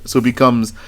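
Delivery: ordinary talk, nothing marked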